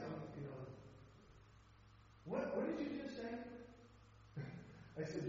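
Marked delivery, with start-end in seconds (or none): 2.2-3.3 higher-pitched, taken-aback question; quizzical. Retold or imitated. Inmate to chaplain after hearing he's hell-bound, quizzical.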